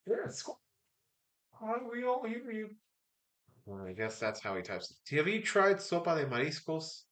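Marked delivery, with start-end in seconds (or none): 0.1-2.7 high-pitched mocking quote of puzzled viewers; mock viewer reaction. Streamer imitates people reacting to all-caps typing, high mock voice. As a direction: put on a high little voice for the commenters. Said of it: "people are like".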